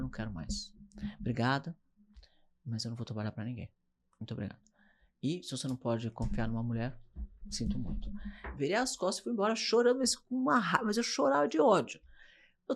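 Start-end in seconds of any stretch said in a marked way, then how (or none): 0.0-8.1 low, softly spoken resignation; quiet firm refusal. Retold or imitated. Businesswoman refusing the distributor deal, quiet and firm. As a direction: turn them down quietly, voice kept low.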